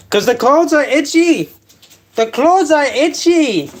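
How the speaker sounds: high, exasperated whine about itchy clothes; mock whine. Comedian mock-whining to the audience.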